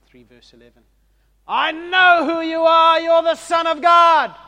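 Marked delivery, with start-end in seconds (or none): 1.5-4.3 loud, high, slow demonic shriek; demons screaming. Acted. Demons screaming at Jesus in terror, shrieking. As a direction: shriek it out like a possessed man. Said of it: "some demons scream out".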